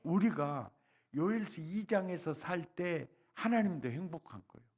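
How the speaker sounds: ordinary talk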